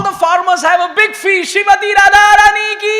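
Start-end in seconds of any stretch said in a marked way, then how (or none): 1.5-3.0 devotional call. Real, not acted. Calling out a devotional cheer.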